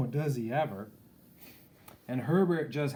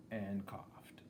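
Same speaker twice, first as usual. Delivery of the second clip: softer and softer, trailing away; fading off; 2 semitones lower, 8 dB quieter than usual. A narrator's reading. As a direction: let the last one die away. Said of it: "Herbert coughed and coughed".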